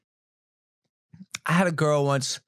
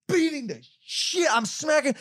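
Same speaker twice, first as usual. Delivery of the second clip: high, strained-up storytelling; worked-up retelling; 12 semitones higher than usual. Retold or imitated. Boxer reliving the escalating smacks, worked-up. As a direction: build the frenzy with raised pitch.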